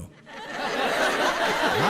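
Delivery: soft laughing yes; breathy laugh. Real, not acted. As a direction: laugh it off quietly. Laughing at own joke.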